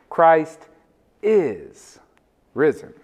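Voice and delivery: "is" set apart by pauses and drawn out; Christ... IS... risen, landing firmly on "is"; stress on IS. Shown as a demonstration. Preacher shows church the right emphasis, stress on IS. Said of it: "place the right emphasis on the right syllable".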